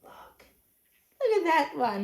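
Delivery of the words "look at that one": usual speaking voice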